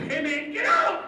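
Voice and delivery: high, loud urgent yell; house shouting orders. Acted. House speaks to owner as bomb falls, shouted warning. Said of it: "then it will speak to you".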